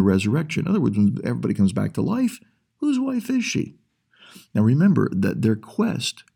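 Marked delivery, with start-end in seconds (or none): 2.8-3.8 mock trick question. Acting out the leaders' trick question.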